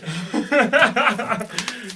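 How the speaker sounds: loud high burst of laughter; laughing. Real, not acted. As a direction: crack up hard. Laughing after prank.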